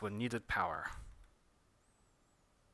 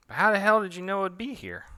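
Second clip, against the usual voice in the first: much higher and louder, incredulous; astonished; 10 semitones higher, 8 dB louder, faster than usual. Acted. Character baffled the grapefruit is there, astonished. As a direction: demand it in disbelief.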